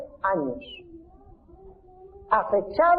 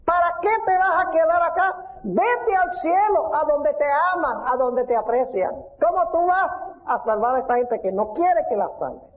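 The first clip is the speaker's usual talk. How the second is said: high, coaxing devil's voice; tempting Satan; 6 semitones higher than usual. Retold or imitated. (Satanás seductor con voz aguda)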